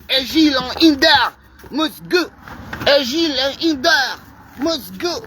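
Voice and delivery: put-on French accent on a protest slogan; mock French accent. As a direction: pretend to be French, chanting the slogan. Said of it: "pretend to be a French".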